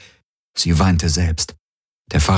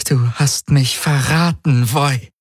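slow, higher, bitter accusation; contained fury, pitch raised; voice twisted by rage; 7 semitones higher, slower than usual. A narrator's reading. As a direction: let anger strain each word. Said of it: "Dann verzerrte Wut ihre Stimme".

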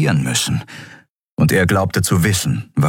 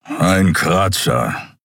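gruff understatement; much slower than usual. Acted. Keru tells Xea it's nothing, slow gruff. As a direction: play the wound down.

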